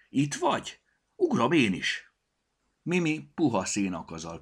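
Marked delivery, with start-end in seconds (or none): 0.1-2.1 calling out. Acted. Calling down to the cat.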